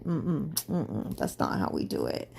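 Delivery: low, hummed refusal and correction; disapproving spirit. Acted. Her spirit correcting her self-talk, low disapproving murmur. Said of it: "my whole spirit was like".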